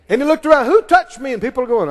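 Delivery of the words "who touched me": raised, demanding question; a preacher voicing Jesus asking; searching Jesus. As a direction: call out over the crowd.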